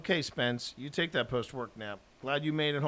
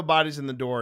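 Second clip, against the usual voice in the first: mock call-out; 8 dB louder than usual. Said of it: "I go".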